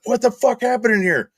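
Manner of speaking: shocked. Retold or imitated. Boss sees blood, retold.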